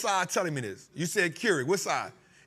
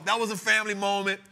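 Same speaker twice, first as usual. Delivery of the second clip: usual speaking voice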